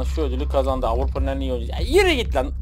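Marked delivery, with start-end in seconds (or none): 1.7-2.5 scornful. Real, not acted. Dismissing Messi's award.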